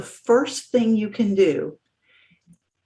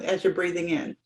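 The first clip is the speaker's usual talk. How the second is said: normal talking voice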